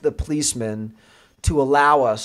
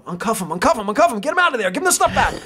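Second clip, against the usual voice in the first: panicked cop; 8 semitones higher, much faster than usual. Retold or imitated. Panicked cop covering the phone.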